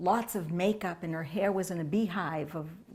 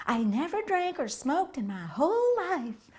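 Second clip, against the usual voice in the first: high, emphatic lady voice, English accent not attempted; imitated English lady; 10 semitones higher, 4 dB louder than usual. English lady answering guru about the rules, high imitated protest. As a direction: protest in a high mock-feminine voice. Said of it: "she said in English, um, English accent, so I couldn't do that".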